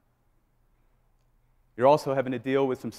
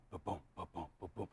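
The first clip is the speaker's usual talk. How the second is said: heartbeat thump; 7 semitones lower, 21 dB quieter than usual. Retold or imitated. Imitating heartbeat.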